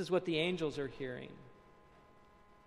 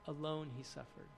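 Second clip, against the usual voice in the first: much softer, lower, almost to himself; hushed repeat; 3 semitones lower, 11 dB quieter than usual. Acted. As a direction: drop to a solemn near-hush for "Alone, he suffered.". Preacher repeats line for emphasis.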